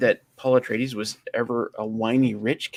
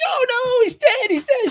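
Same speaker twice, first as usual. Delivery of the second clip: very high, loud panicked wail; exaggerated hysterical cry of grief; mock freaking out; 6 dB louder than usual. Film fan imitates mom learning dad is dead, mock freaking out. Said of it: "She's freaking out".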